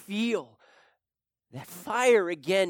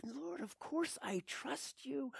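hushed reply; 8 semitones higher, 13 dB quieter than usual. Retold or imitated. Hushed answer to the Lord.

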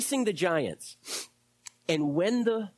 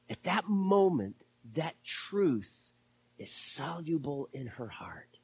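ordinary talk, nothing marked

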